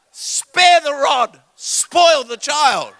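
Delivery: high, loud, very slow, every word spelled out; stern scripture quote. Retold or imitated. Preacher recalling the rule from his childhood home, stern and slow.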